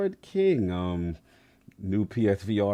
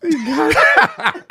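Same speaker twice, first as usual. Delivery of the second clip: much higher, slow laughing echo; 5 dB louder, much slower than usual. Real, not acted. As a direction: squeal it through laughter.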